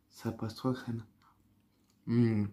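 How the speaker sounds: normal talking voice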